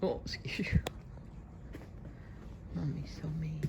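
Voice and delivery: whispering. Real, not acted. Whispering to the dog.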